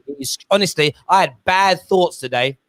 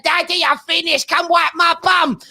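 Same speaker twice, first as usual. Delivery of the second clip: dad mimicking his son calling from the loo, much higher; little boy voice; 11 semitones higher than usual. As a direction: call out like a kid from the toilet. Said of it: "Archie's going".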